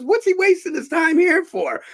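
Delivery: very high, loud protest; acted-out incredulity. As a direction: exclaim it high in disbelief. Old actor incredulous, exclaiming.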